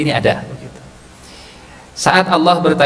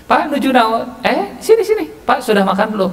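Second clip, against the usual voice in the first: cheery neighbourly calls; 12 semitones higher than usual. Acted. Friendly neighbourly small talk.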